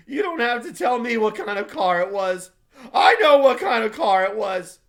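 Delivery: high, loud, frantic cries; frantic Wilson. A narrator's reading. Grieving husband screaming at the crowd, frantic. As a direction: cry it out like a broken man.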